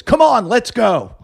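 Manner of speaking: high, slow heckler's rallying cry; mock rallying call. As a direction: imitate a heckler rousing a crowd. Heckler rallying the whole audience.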